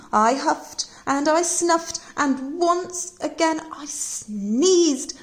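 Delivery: slow build to sneeze. Acted.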